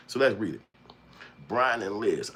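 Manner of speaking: normal talking voice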